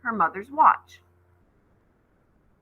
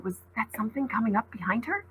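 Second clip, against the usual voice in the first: usual speaking voice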